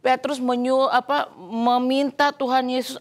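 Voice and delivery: ordinary talk, nothing marked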